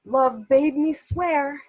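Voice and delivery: theatrical soliloquy with deliberate pauses, pitch climbing toward the end; Proteus, torn lover. Acted. Proteus deciding between two women, torn stage soliloquy.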